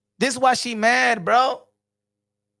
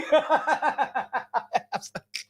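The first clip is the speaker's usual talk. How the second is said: a falling laugh, softer; 5 dB quieter than usual. Real, not acted. Streamer laughing after mocking a photo, falling laugh.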